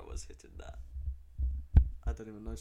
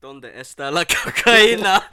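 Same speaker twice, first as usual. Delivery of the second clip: much louder, higher, drawn-out shout; mock Spanish outburst; 6 semitones higher, 19 dB louder, slower than usual. Host asks what happened, co-host blurts nonsense: comic shout. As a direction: belt it out slowly in Spanish.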